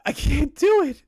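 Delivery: high-pitched protest; distressed protest. Real, not acted. Streamer who can't read the next one, distressed. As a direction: balk in a high, pained voice.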